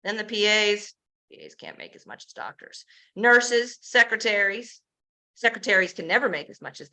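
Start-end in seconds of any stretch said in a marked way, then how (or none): 1.3-2.8 a quick muttered jab under the breath; whispered aside. Real, not acted. Sarcastic aside about clinic pay.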